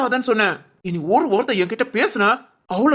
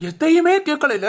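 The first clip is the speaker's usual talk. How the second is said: higher, exasperated outburst; exasperated scolding; 6 semitones higher, slower than usual. Acted. Husband scolds wife who never listens, exasperated.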